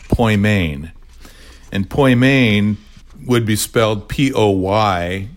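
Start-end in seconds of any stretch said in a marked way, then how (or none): 0.1-0.9 poimen said slowly as POY-mane, stress on the second syllable; Greek pronunciation of poimen. Shown as a demonstration. Guest teaching the host the Greek word, slow careful demo. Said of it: "an English form of saying a Greek word". 1.9-2.8 poimen said slowly and a bit louder, POY-mane; Greek pronunciation of poimen. Shown as a demonstration. Explaining the ministry's name to listeners, careful pronunciation demo. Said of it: "accent on the second syllable".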